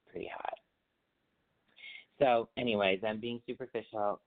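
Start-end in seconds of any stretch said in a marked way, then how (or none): 0.1-0.5 whispered. Real, not acted. Confiding crush aside.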